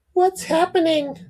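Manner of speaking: a high tender mum; mother's voice. Acted. Mum at son's bedside, high falsetto mother voice. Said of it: "said Mum".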